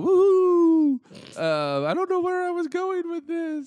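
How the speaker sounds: very high, wavering ghost voice; spooky ghost voice. Pretend. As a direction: float the words like a lost ghost. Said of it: "why was I doing a spooky ghost voice".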